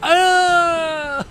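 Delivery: long high, loud "aah"; startled yell. Real, not acted. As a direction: cry out in dismay, drawn out. Startled cry while shooting in game.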